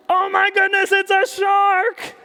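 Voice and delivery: high loud alarmed gasp; gasp of shock. Acted. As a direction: act shocked for the children. Reader reacts to shark.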